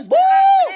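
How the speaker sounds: loud, high drawn hoot; jeering whoop. Acted. Classmates jeer the singer, a loud high hoot.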